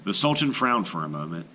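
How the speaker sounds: ordinary delivery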